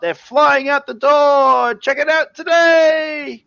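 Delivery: hammed-up infomercial hype; hyped salesman. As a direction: shout the closer like an infomercial host. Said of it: "You gotta sell it".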